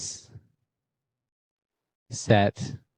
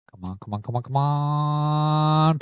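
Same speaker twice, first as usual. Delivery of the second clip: psyching myself up; 5 semitones higher than usual. Real, not acted. Urging the pack, stretched out.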